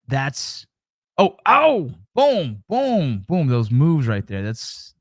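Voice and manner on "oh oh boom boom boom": high, loud bursts of "boom"; excited. Real, not acted. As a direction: hype up your own moves. Reacting to his own clip.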